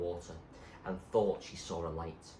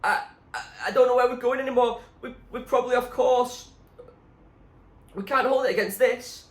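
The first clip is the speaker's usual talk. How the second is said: panicked shout; 10 dB louder than usual. A narrator's reading. Zoe panicking in storm at sea.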